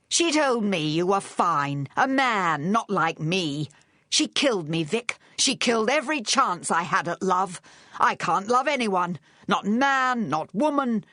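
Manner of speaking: high, overwrought accusation; anguished rant. A narrator's reading. Charles ranting to Vic at gunpoint, anguished. Gunman ranting at his rival.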